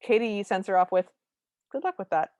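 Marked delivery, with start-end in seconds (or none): 1.7-2.3 matter-of-fact. Retold or imitated. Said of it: "very matter of fact".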